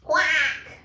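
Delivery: loud duck quack. Acted. Reading Duck's line to kids, loud quack. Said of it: "said duck".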